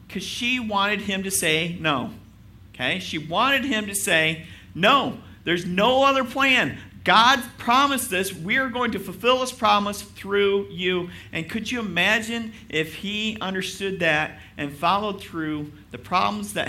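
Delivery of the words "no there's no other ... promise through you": firm passionate declaration. Retold or imitated. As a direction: proclaim it with conviction. Voicing Sarai's wished answer.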